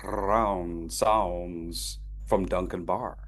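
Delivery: slow "Bar" with a rolled R; drawn-out demo. Shown as a demonstration. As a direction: stretch out "Duncan Bar", rolling the R. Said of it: "Wait, it should be bar".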